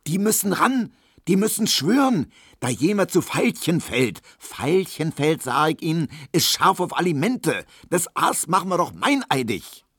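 high, agitated urging; worked up. A narrator's reading. Character riling colleagues to act, worked up. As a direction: push each phrase up with rising heat.

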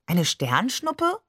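puzzled question, drawn out and rising; puzzled echo. A narrator's reading. Child echoes an uncle's puzzling phrase.

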